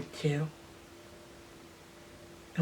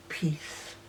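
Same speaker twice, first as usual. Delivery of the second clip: whispered; 3 semitones lower, 12 dB quieter than usual. Real, not acted. Finding refuge in the church.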